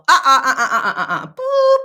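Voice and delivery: high, loud, laughing uh-uh-uh ending in a boop; mock buzzer to wipe the slate; playful erasing noise. Shown as a demonstration. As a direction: cut the thought off with a silly boop sound. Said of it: "I then say to myself".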